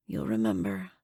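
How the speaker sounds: low, strained croak; hoarse weak voice. Acted. Abigail warning the new captive, hoarse and strained. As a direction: struggle to get it out. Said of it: "Abigail's hoarse voice struggles to say".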